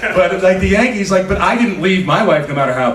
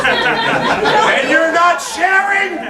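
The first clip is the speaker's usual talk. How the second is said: high-pitched, drawn-out mock indignation; mock outrage; much slower than usual. Comic calls out drinkers in the crowd, mock outraged. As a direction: protest slowly and high, through laughter. Heckling audience over smuggled vodka.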